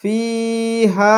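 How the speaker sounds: ফিহা with ি and া both held long, very slow; long vowels stretched. Shown as a demonstration. Teacher explaining why both letters are lengthened, stretched. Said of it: "দুইটাই আমাদেরকে টানতে হচ্ছে".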